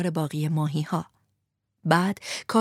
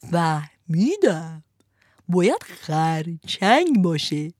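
1 slow deliberate verdict; 2 semitones lower, much slower than usual. Acted. Fisherman slowly decides.

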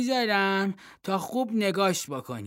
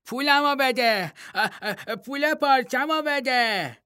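put-on childish shout; 8 semitones higher than usual. Vardilo yelling at the silent statue for his money. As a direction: yell it at the statue like a stubborn kid. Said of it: "واردیلو فریاد زد".

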